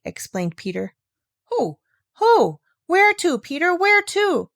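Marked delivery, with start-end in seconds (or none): 1.4-4.5 much higher, louder anxious questions; a frightened old woman pressing the boy; agitated. A narrator's reading. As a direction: fire off the questions in alarm. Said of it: "growing still more agitated".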